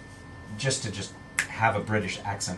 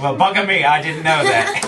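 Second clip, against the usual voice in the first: loud, higher mock-British exclamation; British accent; 7 semitones higher, 10 dB louder than usual. Host jokes with the cast about accents, British accent. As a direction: play up British astonishment with a laugh. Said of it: "we're speaking in British accents from now on".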